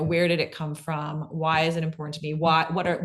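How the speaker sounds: ordinary talk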